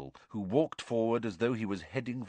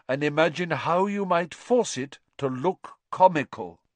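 higher, kindly lecturing professor; Lupin, genial teacher; 8 semitones higher, 4 dB louder than usual. A narrator's reading. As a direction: explain with amused warmth. Lupin addresses class.

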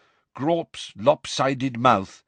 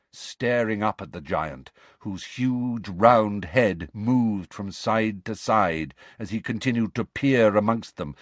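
ordinary delivery, nothing marked

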